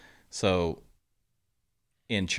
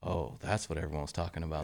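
softer, subdued realization; awkwardly quiet; 3 semitones lower, 8 dB quieter than usual. Retold or imitated. Two stunned teammates alone in the weight room, awkwardly quiet. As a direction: say it under an awkward hush. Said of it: "it's, like, really awkwardly quiet".

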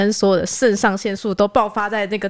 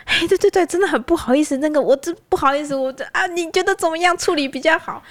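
apologetic pleading; 8 semitones higher than usual. Retold or imitated. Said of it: "我就说".

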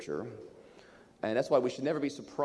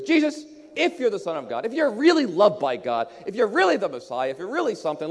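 acted challenge, higher and louder, leaning on REALLY; taunting tempter; 6 semitones higher, 5 dB louder than usual. Satan taunts Jesus about being Son of God, taunting. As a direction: goad him with mock doubt.

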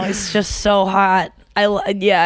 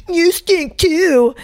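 mocking husband; 8 semitones higher, much slower than usual. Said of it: "do the thing of".

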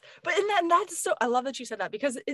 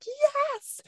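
high-pitched, drawn-out cheer; excited yes; 13 semitones higher than usual. Retold or imitated. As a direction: squeal it in eager agreement. Acting out her excited agreement.

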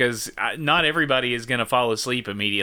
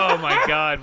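laughing while talking; real laughter; 2 semitones higher, 3 dB louder than usual. Real, not acted. Host reacting to friends' swearing accents, laughing. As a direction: get the words out through laughter.

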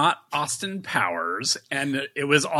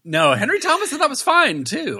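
much higher, earnest-sounding insistence; mock innocent squeak; 4 dB louder than usual. Defending Henry Thomas, a high mock-innocent insistence. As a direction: play innocent to the interrogator. Mock nervous interrogation answer.

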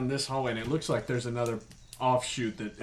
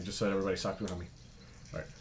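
normal talking voice

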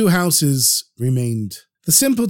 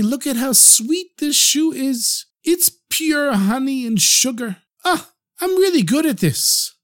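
higher, slow, blissfully pleased simpleton; overjoyed simpleton; 8 semitones higher than usual. A narrator's reading. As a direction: savour every word like honey, voice lifted. Simpleton praises his shoe.

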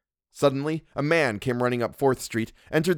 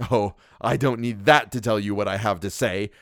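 usual speaking voice

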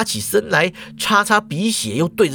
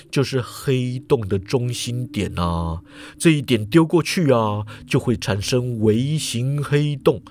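explaining professor; 6 semitones lower than usual. A narrator's reading. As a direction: lecture it low and unhurried. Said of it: "严教授就解释啦".

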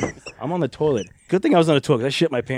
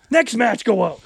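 curt order; 10 semitones higher, slower than usual. Retold or imitated. Cornette after tirade, retold.

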